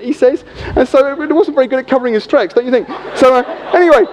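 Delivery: normal talking voice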